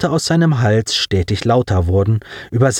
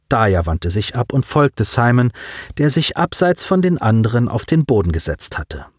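ordinary talk, nothing marked

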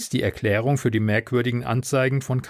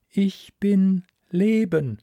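slow, higher, otherworldly voice; drawn-out alien calm; alien sea voice; 7 semitones higher, slower than usual. Acted. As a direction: speak as a vast ocean mind, unhurried. Alien sea mind speaks.

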